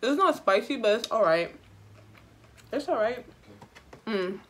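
talking through a mouthful; chewing while she talks; mouth full. Real, not acted. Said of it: "Let me take a bite".

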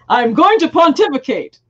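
hammed-up lofty proclamation; mock pompous. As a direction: announce it with playful pomposity. Mock example of stilted dialogue.

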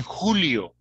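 "Julio" with a throaty H sound; rough H opening "Julio"; Spanish J. Shown as a demonstration. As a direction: model standard Spanish "Julio". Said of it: "No dicen".

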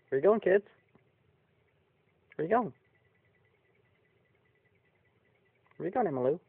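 sing-song high call after the animals; high pet-talk calling. Real, not acted.

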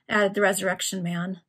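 plain reading voice

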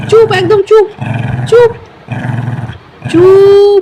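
loud, high order for silence; commanding hush. Real, not acted. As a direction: shout it down, stretching the last word. Ordering noisy pet to be quiet.

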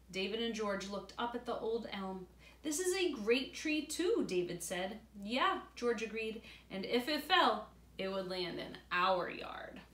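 put-on reading aloud to children; story narration. A narrator's reading.

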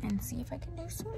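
soft unvoiced whisper; whispering the plan home. Real, not acted. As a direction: breathe the words, barely voiced. Whispering while filming.

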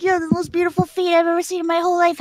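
fast run-through of the man's line; quick, matter-of-fact quote; hurried retelling. Retold or imitated. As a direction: rattle off the quoted compliment quickly. Repeats the line, running on.

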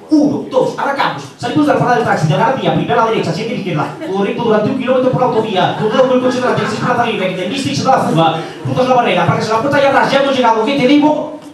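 fast, high rally co-driver calls; Luis Moya. Acted. Co-driver directs the taxi like a rally, rapid excited Moya impression. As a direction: rattle off directions urgently, building to a peak. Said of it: "Es Luis Moya, el copiloto de Carlos Sainz".

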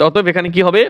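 usual speaking voice

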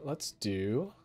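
usual speaking voice

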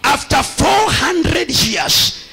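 fervent sermon call and response; preaching. Acted. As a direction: preach the verse and quiz them. Preacher quoting scripture, quizzing.

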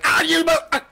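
mock dying gasp. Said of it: "It's just gonna be like".

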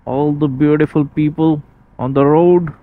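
calling out over road noise in a helmet; inside a helmet. Real, not acted. Helmet mic clip while riding.

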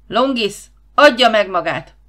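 loud, commanding male shout; booming megaphone command. A narrator's reading. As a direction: thunder an order over loudspeakers. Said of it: "A megafonokban egy férfi hang dörrent".

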